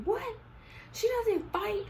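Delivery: high-pitched disbelieving question, rising at the end; shocked mom. Retold or imitated. Mom hearing her daughter fought, mock shock. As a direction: imitate a mother who is shook. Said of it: "my mom was shook".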